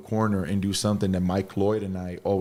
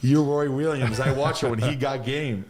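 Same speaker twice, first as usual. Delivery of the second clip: higher, gushing retold fan reaction; starstruck excitement; 5 semitones higher than usual. Player retelling meeting a famous coach, starstruck excitement. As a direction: blurt it out like an amazed fan.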